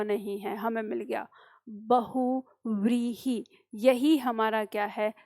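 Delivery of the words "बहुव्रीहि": बहुव्रीहि said very slowly with a clear व; careful pronunciation. Shown as a demonstration. Teacher spells out बहुव्रीहि slowly, stressing the व sound. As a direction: linger on the व in बहुव्रीहि. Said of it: "सही उच्चारण है".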